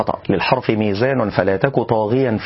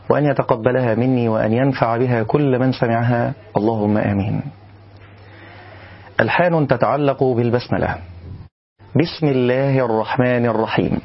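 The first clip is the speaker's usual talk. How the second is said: ordinary delivery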